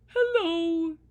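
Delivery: high, loud barky dog voice; eager shaggy dog barking hello. Acted. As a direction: bark the greeting like a big friendly dog. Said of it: "she barked".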